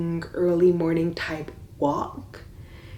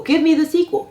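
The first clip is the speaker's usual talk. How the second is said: excited high plea; 10 semitones higher, 6 dB louder, faster than usual. Real, not acted. Repeated demand for sequel.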